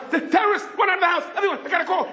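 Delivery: very high frantic warning; waking the whole house, panicked alarm. Acted. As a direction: shout the alarm, high and urgent.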